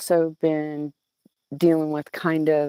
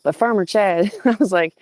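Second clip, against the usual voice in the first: usual speaking voice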